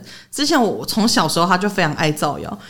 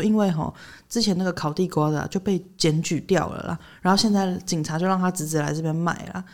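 hushed gossip; 7 dB quieter than usual. Retold or imitated. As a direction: keep it down so the vendor can't hear. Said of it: "她就很小声的跟我讲说".

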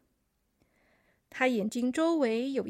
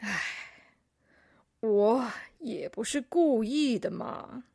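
slow, sighing excuse; put-upon chameleon, drawn-out words; 2 semitones lower than usual. A narrator's reading. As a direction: sigh first, then protest slowly. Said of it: "叹了一口气说".

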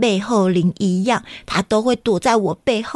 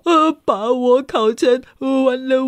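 ordinary delivery

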